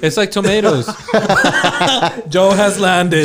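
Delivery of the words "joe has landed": laughing. Real, not acted. Laughing at the joke.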